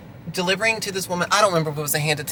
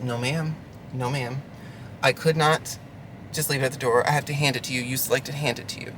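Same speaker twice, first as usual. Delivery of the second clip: soft, lower, restrained reply; 4 semitones lower, 7 dB quieter than usual. Retold or imitated. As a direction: keep it quiet and measured, holding back. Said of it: "it's good to take the high road".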